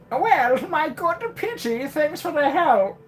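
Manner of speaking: very high squeaky character voice. Acted. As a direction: pitch it way up, squeaky. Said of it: "That is not the voice I gave".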